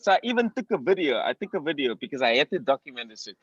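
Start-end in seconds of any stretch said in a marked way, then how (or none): none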